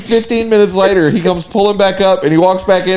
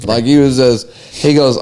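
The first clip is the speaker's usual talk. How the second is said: ordinary talk, nothing marked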